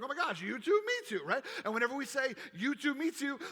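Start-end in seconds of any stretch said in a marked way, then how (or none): 0.0-1.2 Me too squeaked much higher; light up at finding a kindred soul; excited recognition. Retold or imitated. 2.5-3.4 rising, brighter tone on You too; voice goes up. Shown as a demonstration. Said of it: "our voice goes up".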